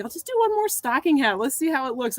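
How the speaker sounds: high-pitched retold thought; chirped self-quote. Crafter recalling a decision on leftover ink, high self-quote. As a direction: quote your own thought, pitched well up. Retelling her own decision.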